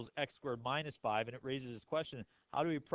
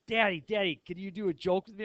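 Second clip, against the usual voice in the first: put-on higher, eager little-girl excitement; excited child; 7 semitones higher, 5 dB louder than usual. Daughter begging dad for a joke, excited. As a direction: imitate a daughter bursting to share a joke. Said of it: "she's so excited".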